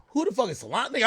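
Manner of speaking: ordinary delivery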